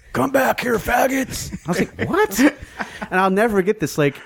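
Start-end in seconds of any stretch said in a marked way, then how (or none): 0.1-1.5 high-pitched mock yell; retold scream. Angry skinhead yelling after a fleeing van, mock scream. 2.1-2.4 very high, incredulous yelp; startled disbelief. Retold or imitated. Bandmate reacting to being yelled at, startled disbelief.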